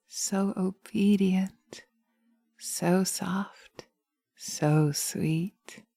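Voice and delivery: hushed, slow, swaying trance voice; hypnotic induction. Acted. Witch leads a pendant induction.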